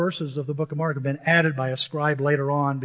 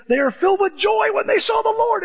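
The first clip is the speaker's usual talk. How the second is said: very high, loud giddy voice; giddy disciples, pitched very high; mock joy; 5 dB louder than usual. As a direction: squeal with delight. Preacher acts out joy.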